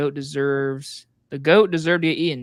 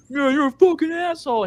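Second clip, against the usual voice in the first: high mock-angry jab; jealous outburst; 11 semitones higher, 3 dB louder than usual. Film character jealous of the guy at the presentation, lashing out. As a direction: imitate a jealous, high-pitched insult. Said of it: "like, jealous of the guy".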